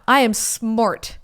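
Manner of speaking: slow, drawn-out "smart"; mock boast. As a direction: stretch "I am smart" out slowly, playing clever. Self-praise repeated, drawn out for effect.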